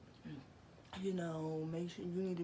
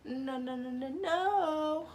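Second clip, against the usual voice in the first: high, louder, slow sing-song no; playful stretched denial; high drawn-out no; 9 semitones higher, 8 dB louder, slower than usual. Real, not acted. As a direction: drag the nos out teasingly. Teasing denial.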